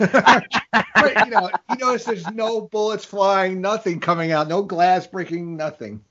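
laughing high voice. Real, not acted. Laughing excited commentary.

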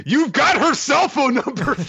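high-pitched exasperated outburst; mock exasperation. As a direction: squeal it in comic frustration.

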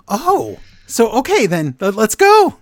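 high, stammering turnaround; mock sudden eagerness. Recapper as wrestler suddenly ready to help, mock eagerness. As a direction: jump from realization to loud, high readiness. Acting out Owens suddenly relieved.

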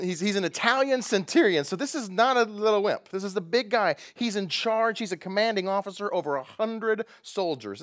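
ordinary talk, nothing marked

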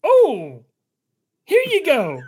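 cheery robot helper; chipper assistant. Acted. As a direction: hand it over like an eager AI. Genie hands over pass.

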